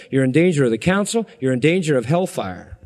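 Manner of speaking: normal talking voice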